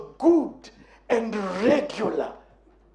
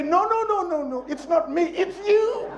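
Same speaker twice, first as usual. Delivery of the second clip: high, coy laughing no-no-no; coy modest denial; 6 semitones higher than usual. Retold or imitated. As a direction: brush off the praise coyly, laughing. Acted modest reply to compliment.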